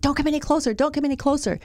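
mock angry yell. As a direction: imitate an agitated man warning someone off. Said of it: "he starts yelling at me".

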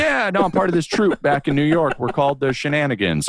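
bragging fake improv guy. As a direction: brag like a smooth liar. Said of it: "And I'd be like".

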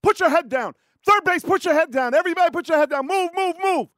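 shouting orders over battle. Retold or imitated. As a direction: bark orders at the crowd. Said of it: "And they go".

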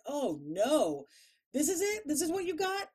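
high disappointed whine. Retold or imitated.

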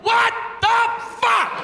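very high, slow, shouted outrage; horrified shriek. Retold or imitated. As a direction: shriek it at the top of your range. Viewer horrified by the scene.

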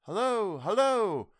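high, loud hail from afar; loud far-off hello; distant calling. A narrator's reading. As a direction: call out across the dark. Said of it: "as of many voices calling in unison".

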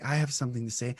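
soft, low and timid-sized; quiet voice. Shown as a demonstration. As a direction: say it at very low volume. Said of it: "Do you speak like this".

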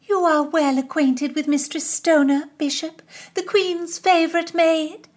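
high, bright-eyed gushing princess; princess's flattering voice. Acted. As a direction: pour the praise on thick, up high. Princess introduces Constance.

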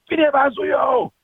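high, slow drawn-out line reading; acted character line. Voice actor reading a line with no lead-in, high and slow. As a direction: stretch it out high and slow like a cold read. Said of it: "if I have to be like".

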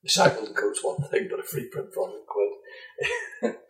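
sheepish whispered aside; whispering. Real, not acted. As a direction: whisper it, a little ashamed. Embarrassed aside continues.

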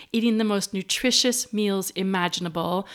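ordinary delivery

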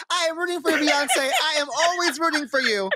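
high, gushing fan praise; excited. Real, not acted. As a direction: cheer her on, beaming. Gushing over [name].

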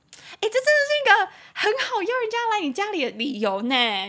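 loud, high-pitched mock discovery; excited realization. Teacher spotting an excuse to invite someone home, excited. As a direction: burst out high and loud with delight. Delighted at own dating trick.

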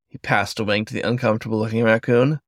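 usual speaking voice

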